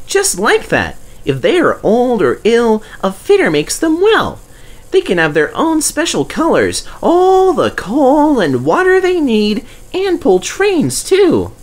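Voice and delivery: high, eager little engine voice; Percy's voice. Acted. Percy reminding Douglas, in a high eager voice. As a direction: play the small cheeky tank engine.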